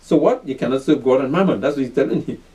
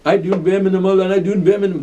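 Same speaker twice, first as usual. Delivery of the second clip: lightly mocking singsong of a lazy worker; a hint of sneering at slackers' excuses; 3 semitones higher than usual. Preacher mimics lazy workers to his class, lightly mocking. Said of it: "I hear it all".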